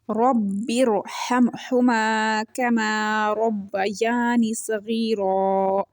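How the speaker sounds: slow, drawn-out tajweed chant; Quranic recitation. Shown as a demonstration. Teacher recites the verse for her students, slow chant. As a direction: recite the verse slowly, stretching each vowel. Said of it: "ये मिसाल है".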